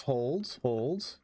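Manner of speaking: ordinary delivery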